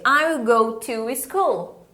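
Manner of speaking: "I will go to school" spoken slowly with a long L on "school"; unhurried teacher model; slow model sentence. Shown as a demonstration. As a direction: model it for students to copy.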